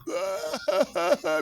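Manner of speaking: loud, high burst of fake sobbing; exaggerated bawling cry; mock wailing. Preacher imitating soldiers crying for the congregation, mock wailing. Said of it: "Mas homem chorando, misericórdia".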